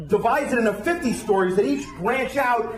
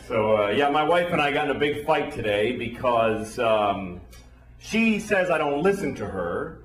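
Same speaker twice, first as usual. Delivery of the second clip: ordinary delivery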